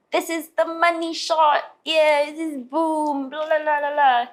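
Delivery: high, drawn-out gushing; imitating enthusiastic producer. Producer thrilled with the finished vocals, mock enthusiasm. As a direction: hype it up, high-pitched and over the top. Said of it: "never experienced this kind of enthusiasm".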